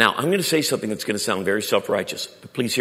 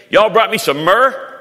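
mock surprised question; 10 semitones higher, 11 dB louder, slower than usual. Mock gift-giver voice.

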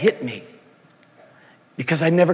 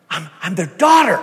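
higher, louder retold cry; 5 semitones higher, 5 dB louder than usual. Preacher recalling the girl realizing her adoption, crying out. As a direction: stumble in, then cry out the last word. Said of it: "she cried out".

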